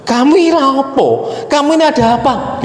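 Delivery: high, bewildered father's voice; startled father. Retold or imitated. Father sees his son crying in the car, high and bewildered. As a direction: play the puzzled father, voice jumping up.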